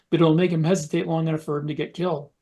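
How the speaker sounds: ordinary talk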